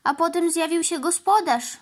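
a hurt cow telling her tale; lightly voiced sorry cow; plaintive cow. A narrator's reading.

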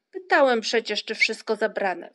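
loud, reproachful interjection; exasperated father's reproach. A narrator's reading. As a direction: cut in with a loud rebuke. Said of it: "wtrącił z wyrzutem tatuś Muminka".

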